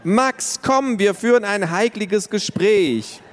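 put-on high child calling her brother. A narrator's reading. Tiffany calls her brother in from the terrace, high. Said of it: "rief Tiffany".

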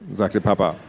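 ordinary delivery, nothing marked

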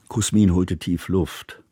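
usual speaking voice

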